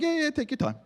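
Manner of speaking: mock eager agreement. Mock agreeable husband reply.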